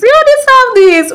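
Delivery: high sing-song self praise. Retold or imitated. Self-talk while taking selfies.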